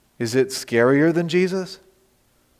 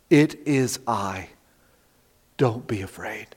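slow, softer calm reassurance; reassuring; 6 semitones lower, 8 dB quieter, slower than usual. Retold or imitated. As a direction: gently settle frightened friends. Jesus' words to terrified disciples.

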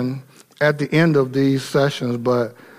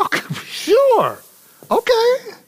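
much higher, slow, sing-song okay sure; mock easy agreement; slower than usual. Preacher mocking a Moses who just agrees, high and drawn out. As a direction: play Moses shrugging yes like a pushover. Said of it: "He didn't say".

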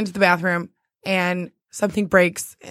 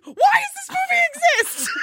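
high laughing shout; laughing shriek. Retold or imitated. Retelling her freaked-out reaction.

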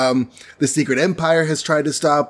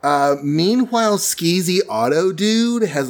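ordinary delivery

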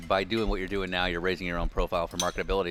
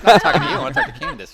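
mock snub; 12 semitones higher, 13 dB louder, faster than usual.

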